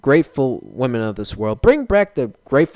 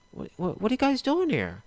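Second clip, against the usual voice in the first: surprised [name]; 9 semitones higher, 6 dB quieter than usual. Pretend.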